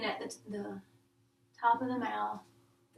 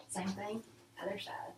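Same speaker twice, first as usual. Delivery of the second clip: whispering; 6 dB quieter than usual. Real, not acted. Quiet aside noting exam findings.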